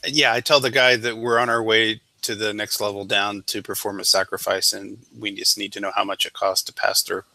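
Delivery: ordinary talk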